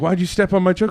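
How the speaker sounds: high, loud mock outraged question; angry. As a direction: bark the question like the angry comic. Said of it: "he was like".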